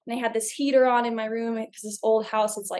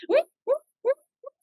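higher mock whoop sound effect; spooky whoop noise; faster than usual. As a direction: imitate an eerie whooping noise. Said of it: "It was like".

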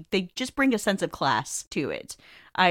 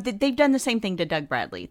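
normal talking voice

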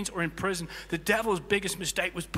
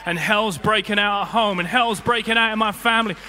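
worshipper crying out; 3 semitones higher, 10 dB louder than usual. Retold or imitated. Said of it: "you fling your arms in the air and you say".

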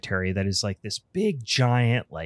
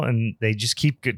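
ordinary delivery

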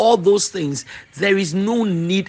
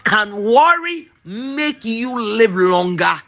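slow, higher, drawn-out question; slow reading; 4 semitones higher, 3 dB louder, slower than usual. Shown as a demonstration. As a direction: linger on every word. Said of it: "listen to how contemporary English version translates".

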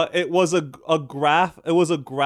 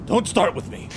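mad scientist. Acted. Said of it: "Igor, quickly".

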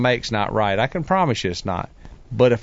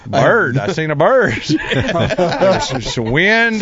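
louder, goofy bird-brained blurt; mock dumb excitement; 5 semitones higher, 4 dB louder, slower than usual. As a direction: blurt it like a distracted kid. Mock dumb answer to marshal.

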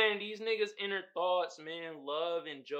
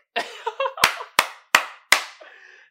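breathy high laugh rising; high wheezing laugh; 10 dB louder than usual. Real, not acted. Laughing at own impression.

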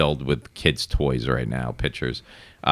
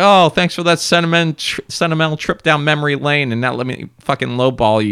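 mock-grateful buyer; 9 semitones higher, 4 dB louder than usual. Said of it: "It's just like".

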